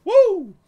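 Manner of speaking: high, loud victory whoop; a real whoop of triumph; triumphant whoop. Real, not acted. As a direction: let out a joyful cheer. Celebrates killing the spider.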